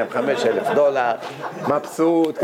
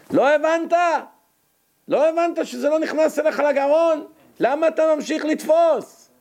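very high-pitched incredulous rebuke; exasperated scolding; 13 semitones higher than usual. Acted. Preacher berating the foolish bird, high exasperated scolding. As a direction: push far up in pitch, berating in disbelief.

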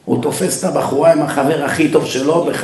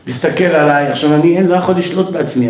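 ordinary delivery

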